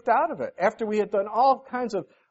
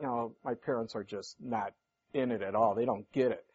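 put-on dismissive kid; 9 semitones lower, 8 dB quieter than usual. Kids dismissing parents' warnings.

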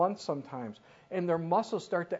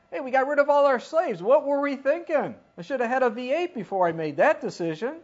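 mock regretful Egyptians; 6 semitones higher, 6 dB louder than usual.

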